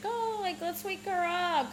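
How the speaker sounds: high, slow, sing-song aunt; a hint of a chirpy aunt; aunt's voice. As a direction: play the cheerful aunt.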